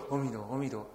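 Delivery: soft, murmured chant of the Buddha's name under the breath; recited inwardly. Retold or imitated. Monk alone at night, reciting softly in his heart. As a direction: murmur it quietly to yourself. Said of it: "我就，我就心里头".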